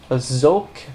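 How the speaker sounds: zoke with a teasing mock French z; a playful French-accented zoke. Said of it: "'Cause that's how they say it".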